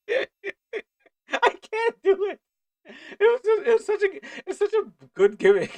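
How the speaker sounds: very high, loud, squealing with laughter; helpless laughter. Real, not acted. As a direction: break down laughing while trying to explain. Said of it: "That's why I'm laughing".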